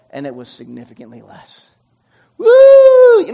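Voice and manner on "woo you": much higher and louder, a joyful cry of relief; relieved whoop. Real, not acted. Relief at lower hospital bill.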